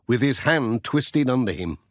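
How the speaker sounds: ordinary delivery, nothing marked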